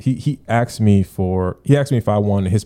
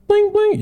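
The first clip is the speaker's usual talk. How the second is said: much higher chime imitation; high mock ping; notification sound; 4 dB louder than usual. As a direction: sing the phone's ding-ding.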